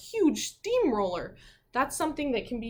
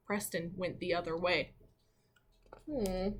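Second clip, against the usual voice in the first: plain reading voice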